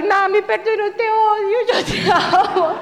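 put-on a light high little-kid plea; lightly voiced child begging forgiveness; child apologizing. Child to crying mom, lightly pleading.

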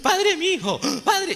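high, wailing imitation of a crying girl; a weeping young woman begging the priest, imitated. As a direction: cry out "padre" up high through sobs. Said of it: "una muchacha que lloraba y lloraba".

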